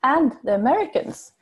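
ordinary talk, nothing marked

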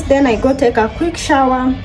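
ordinary talk

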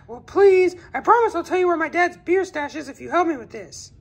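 high, eager little voice; a kid sweet-talking a friend into helping; pleading kid. Acted. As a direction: wheedle like a kid asking a favor.

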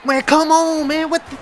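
very high, loud cry of protest; frustrated yell. Real, not acted. Gamer furious at a bad play, high loud yell. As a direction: scream your disbelief.

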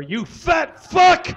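slow, high, furious scream; livid shout. Acted. Chef screaming insults at Paula, livid. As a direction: explode the insult at her. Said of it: "He was positively livid".

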